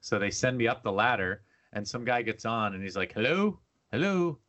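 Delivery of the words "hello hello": high, puzzled hello into a phone; higher, questioning mock phone voice; imitated phone greeting. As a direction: imitate a support guy checking who's there. Imitates tech support guy answering.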